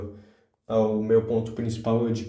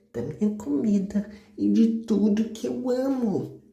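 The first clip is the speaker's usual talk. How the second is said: high, slowed mock-earnest character voice; put-on high voice; 9 semitones higher than usual. Explaining his scrambled brain to viewers in a put-on high voice. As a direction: pitch it way up and draw the words out.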